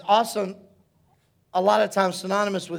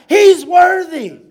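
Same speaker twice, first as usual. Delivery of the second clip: high, slow shout of praise; full-voiced, drawn-out acclamation; 8 semitones higher, 11 dB louder, slower than usual. Shown as a demonstration. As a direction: cry it out loud to heaven. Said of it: "we're shouting out".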